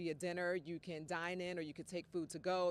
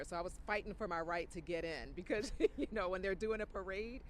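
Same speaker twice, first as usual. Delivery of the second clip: usual speaking voice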